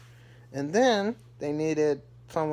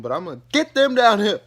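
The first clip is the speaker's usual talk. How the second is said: higher, louder, fast mock huff; determined Todd; 8 semitones higher, 10 dB louder, faster than usual. Todd heading upstairs to fetch his son and mother, annoyed huff. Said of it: "Todd's like".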